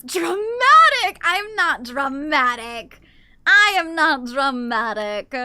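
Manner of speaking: loud, slow hammed-up denial; mock-protesting to chat that she's not dramatic. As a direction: overplay the outrage. Playful denial shouted.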